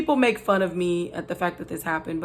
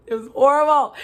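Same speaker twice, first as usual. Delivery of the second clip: laughing exclaim; 5 semitones higher, 5 dB louder than usual. Real, not acted. Laughing recalling leg grab.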